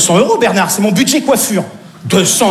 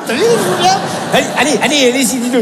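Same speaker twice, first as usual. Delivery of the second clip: ordinary delivery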